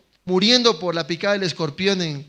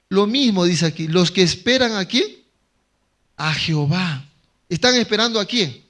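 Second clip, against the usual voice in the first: predicación con fuerza; 6 dB louder than usual. Acted. Preaching closing question.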